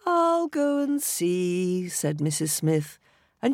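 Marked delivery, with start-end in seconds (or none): 0.1-1.9 wife voice. A narrator's reading.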